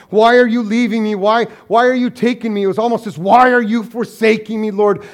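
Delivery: loud pleading cry; retold crying out. Pastor voicing widow's plea, loud lament. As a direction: call out to God, anguished. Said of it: "she'd cry out".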